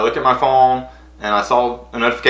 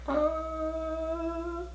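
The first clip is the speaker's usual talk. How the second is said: stunned squeak; 16 dB quieter than usual. Retold or imitated. Overwhelmed by praise.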